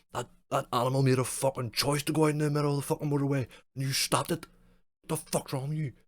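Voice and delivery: hammed-up outrage at a good deed; mock angry troll. Imitating a furious commenter scolding the rescuer, loud rant.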